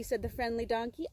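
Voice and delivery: usual speaking voice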